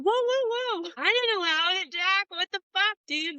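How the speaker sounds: high-pitched, defensive mock protest; squeaky-high retold objection. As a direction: act out a startled denial, voice shooting way up. Acting out own startled protest to Jack.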